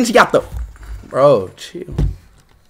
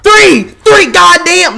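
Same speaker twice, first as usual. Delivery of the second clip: shouting; 5 semitones higher, 9 dB louder, slower than usual. Real, not acted. Yelling at a video glitch.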